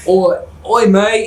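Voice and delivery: high mock Aussie accent; attempted Australian accent. Friend trying out the accent for laughs, Australian accent. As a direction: have a playful go at an Australian accent. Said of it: "hold on, let me try".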